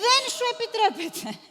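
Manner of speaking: high, cutting put-down; icy mistress silencing a servant; sharp rebuke. Acted. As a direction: slap the servant down with it.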